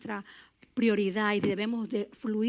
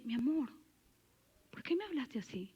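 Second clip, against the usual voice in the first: madre tierna; 10 dB quieter than usual. Retold or imitated. Madre responde con palabra blanda.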